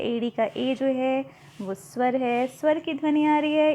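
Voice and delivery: ordinary talk, nothing marked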